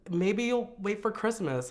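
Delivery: high, tentative imitated suggestion; imitating an anxious, hesitant voice; nervous sister. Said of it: "my sister's just nervous too".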